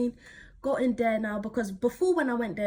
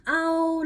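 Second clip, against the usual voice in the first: mild ow; 7 semitones higher, 6 dB louder than usual. Retold or imitated. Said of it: "'Cause I'm just like".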